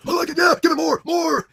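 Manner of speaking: mock lewd cheering.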